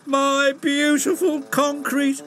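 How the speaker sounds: high, slow moaning lament. A narrator's reading. Patrick moaning over his ruined concrete. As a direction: mourn the spilled load. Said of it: "moaned Patrick".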